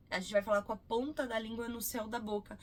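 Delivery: ordinary talk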